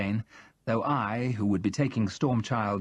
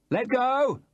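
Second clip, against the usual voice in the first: called out; 13 semitones higher than usual. Acted. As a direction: call the order across the water. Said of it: "I called".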